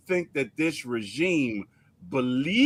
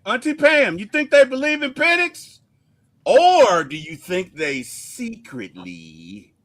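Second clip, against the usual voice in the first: evil villain voice; 5 semitones higher, 5 dB louder than usual. Pretend. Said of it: "I'm doing my evil voice".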